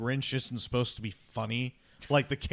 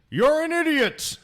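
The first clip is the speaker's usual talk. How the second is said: very high, much louder echo yell; echo shout; 9 dB louder than usual. Retold or imitated. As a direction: blast it back off the walls. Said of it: "echo shouts back".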